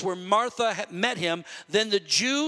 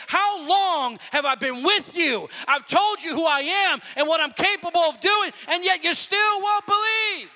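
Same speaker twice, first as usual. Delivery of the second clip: exasperated reproach; 7 semitones higher than usual. Acted. Preacher voices Jesus reproaching those who won't believe, exasperated. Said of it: "He was weeping because he looked up".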